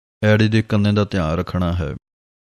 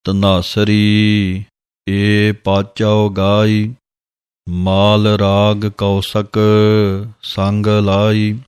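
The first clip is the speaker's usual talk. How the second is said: slow, drawn-out devotional reading of gurbani; slow scripture recitation; much slower than usual. Acted. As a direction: recite each word slowly and reverently. (ਹੌਲੀ ਹੌਲੀ ਗੁਰਬਾਣੀ ਦਾ ਪਾਠ)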